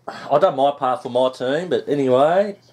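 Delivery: ordinary delivery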